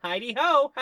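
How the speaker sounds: sing-song like a talking toy from a TV ad; talking doll voice. Acted. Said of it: "And the doll says".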